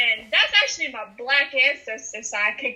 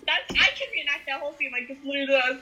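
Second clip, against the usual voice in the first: normal talking voice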